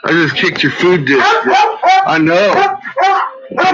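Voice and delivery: laughing while apologizing; giggly apology to the dog. Real, not acted. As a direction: laugh through the words. Laughing after kicking dog dish.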